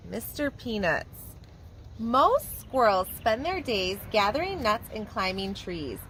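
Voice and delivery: lively, sing-song storybook reading; animated picture-book narration for little kids; reading to children. A narrator's reading. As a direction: read it like a preschool teacher at story time. Teacher reads picture book aloud.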